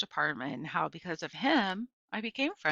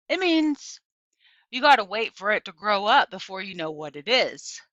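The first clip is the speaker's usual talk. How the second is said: neighbour lady's voice; 7 dB louder than usual. A narrator's reading. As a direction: lift into the neighbour's voice to explain.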